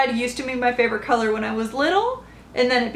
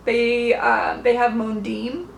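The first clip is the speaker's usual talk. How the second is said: ordinary talk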